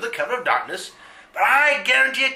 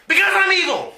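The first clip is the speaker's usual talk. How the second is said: higher, louder boast of evil; proud villain declaration; 8 semitones higher, 4 dB louder than usual. Acted. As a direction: crow it triumphantly.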